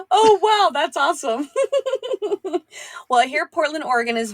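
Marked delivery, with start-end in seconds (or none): 0.0-0.7 high, loud gasp of amazement; real delighted surprise, pitched way up and louder; surprised gasp. Real, not acted. Hearing son does any accent. 0.7-2.6 laughing. Real, not acted. Laughing after the surprise.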